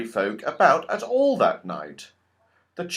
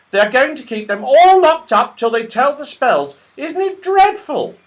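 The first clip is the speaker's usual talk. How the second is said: much higher, quick little squirrel voice; tiny squirrel voice; 13 semitones higher, 10 dB louder than usual. Acted. The red squirrel warns Joe the goblins took everyone, tiny and quick. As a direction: chatter like a tiny worried squirrel.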